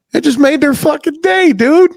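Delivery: high, emphatic enthusiasm; excited exclamation. Real, not acted. Telling how a small kindness lands, high excited enthusiasm. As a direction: exclaim it high, punching the last word.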